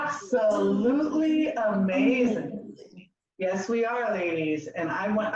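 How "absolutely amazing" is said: slow, stretched-out declaration; group affirmation. Shown as a demonstration. Leader giving the group a line to repeat, slow affirmation. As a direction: draw out each word for the group to copy. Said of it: "we're gonna say".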